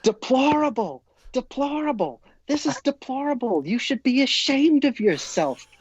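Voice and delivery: high-pitched mock outraged yell; imitated yelling. Mother berating the umpire from behind home plate, mock yelling. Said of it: "she starts yelling".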